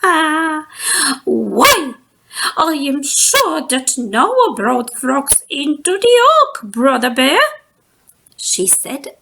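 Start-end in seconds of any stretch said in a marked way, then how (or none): 0.0-1.2 laughing. Acted. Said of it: "Missus Ursula laughed". 1.2-7.4 a touch of amused teasing; light, playful old teacher voice; gently tease, eyes twinkling. Acted. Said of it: "her eyes twinkling".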